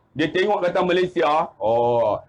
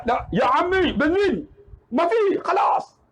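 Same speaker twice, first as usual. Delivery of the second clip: high, emphatic mock shout; exasperated insistence; 6 semitones higher, slower than usual. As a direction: imitate a frustrated stranded driver.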